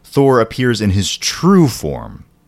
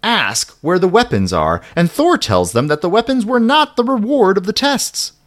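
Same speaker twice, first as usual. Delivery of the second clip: excited; 10 semitones higher than usual. A narrator's reading. Excited build in narration.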